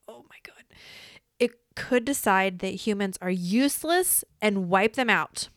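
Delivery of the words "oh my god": breathless unvoiced whisper; stunned whisper. Real, not acted. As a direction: mouth it in shock. Stunned by AI answer.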